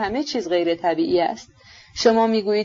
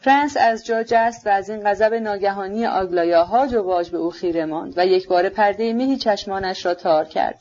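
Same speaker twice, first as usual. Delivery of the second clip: usual speaking voice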